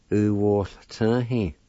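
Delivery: U wall turn he drawn out slowly. Shown as a demonstration. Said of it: "like this".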